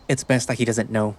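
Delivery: ordinary delivery, nothing marked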